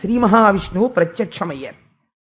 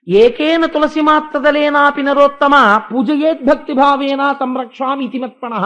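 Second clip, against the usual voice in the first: verse recitation; 4 semitones higher, 5 dB louder than usual. Acted.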